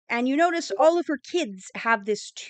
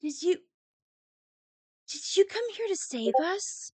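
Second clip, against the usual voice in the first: put-on small, soft hopeful child's voice, rising; a shy little girl asking in a hush; timid little girl; 6 semitones higher, 6 dB quieter than usual. Little girl shyly asking the elf if he came to save them, softly. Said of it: "and she's like".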